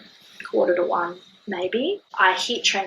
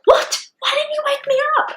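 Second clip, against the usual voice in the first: high, loud, indignant disbelief; mock panicked outcry; 7 dB louder than usual. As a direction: imitate a partner woken in alarm. Acting startled partner just woken.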